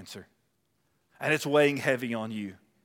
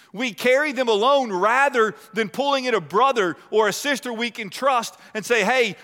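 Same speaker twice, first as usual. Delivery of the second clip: raised, driving pulpit voice; fervent preaching; 7 semitones higher, 5 dB louder than usual. Acted. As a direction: preach it out to the congregation. Pastor preaching at full force.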